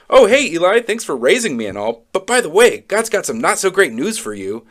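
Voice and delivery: high, falsely cheery rehearsal; mock casual. Child imagines telling Eli bad news, mock casual. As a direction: rehearse it with put-on breeziness, pitch jumping.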